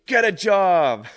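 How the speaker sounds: a mock yell from a passing car, high and loud; retold yell. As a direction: shout it like a heckler out of a car window. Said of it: "and yelled at me".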